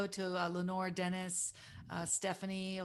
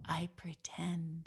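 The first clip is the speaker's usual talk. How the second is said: slow whispered confession; hushed whisper; 3 semitones lower, 7 dB quieter, much slower than usual. Retold or imitated. Lily Tomlin whispering her acting secret. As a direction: lean in and breathe it, slowly. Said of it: "she looked at me and she goes".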